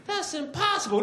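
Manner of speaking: very high doubtful; high skeptical wife; wife's voice. Acted. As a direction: scoff at him.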